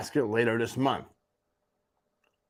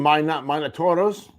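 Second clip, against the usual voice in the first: Toros said with a Spanish accent, rolled and foreign. Shown as a demonstration. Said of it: "without the Spanish accent".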